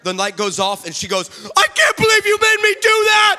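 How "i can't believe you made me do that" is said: very high, loud mock child's screech; outraged outburst. Daughter to dad once the light goes off, mock outraged scream. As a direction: wail it in accusing indignation.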